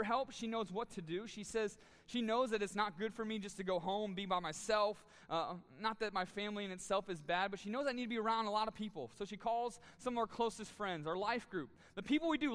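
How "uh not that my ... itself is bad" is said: ordinary talk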